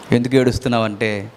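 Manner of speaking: ordinary delivery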